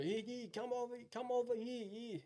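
high, foreign-sounding trucker calling over. Retold or imitated. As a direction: wave him over in a put-on voice. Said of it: "one bloke waves me over".

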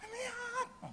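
high-pitched mock scolding; husband grumbling. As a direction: caricature of a nagging husband. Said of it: "suaminya ngomel".

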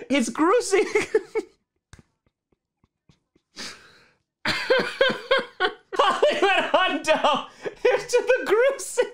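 helpless high-pitched giggling through the words; laughing. Real, not acted. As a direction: crack up while trying to get it out. Uncontrollable laughing fit on stream.

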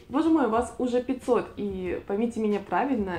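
ordinary talk

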